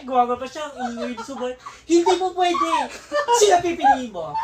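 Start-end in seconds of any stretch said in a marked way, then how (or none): 1.8-4.3 much higher, louder jealous shouting; angry outburst. Retold or imitated. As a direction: yell at him, suddenly truly angry. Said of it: "Bigla na naging totoo yung galit niya". (galit na sigaw)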